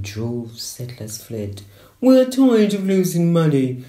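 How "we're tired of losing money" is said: higher, louder mock royal complaint; mocking the Crown. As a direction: lampoon the English crown whining about money. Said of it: "England's crown said".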